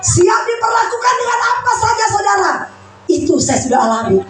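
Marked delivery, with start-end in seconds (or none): 0.0-2.5 preaching shout. Real, not acted. Preacher calling out to congregation.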